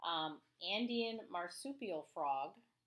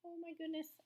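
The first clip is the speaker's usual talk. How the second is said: surprise; 10 semitones higher, faster than usual. Real, not acted. Teacher reacts to giant frog.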